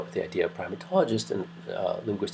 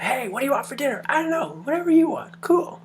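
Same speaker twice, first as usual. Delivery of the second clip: high-pitched, louder caricature of relatives chatting; mock Greek family chat; 6 dB louder than usual. Lecturer imitating Greek relatives asking about dinner, high and loud. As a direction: squeak out a casual dinner exchange as the family.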